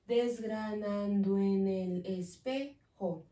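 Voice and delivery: slow, syllables spaced; slow syllable count. Shown as a demonstration. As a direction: stretch "Desgranando en el espejo" syllable by syllable. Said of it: "Tenemos ocho sílabas".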